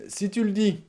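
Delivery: high, throwaway si tu le dis; offhand dismissal. Pretend. Husband tinkering, dismissing his wife's complaint offhandedly. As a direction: toss it off without looking up. Absent reply while tinkering.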